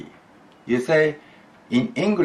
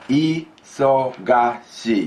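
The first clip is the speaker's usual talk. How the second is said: slow and drawn out; 4 semitones higher, much slower than usual. Shown as a demonstration. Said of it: "just isogashi".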